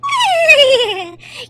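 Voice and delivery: bright triumphant chuckle; pleased laugh; gleeful laugh. Acted. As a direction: laugh with delight. Delighted the trick worked.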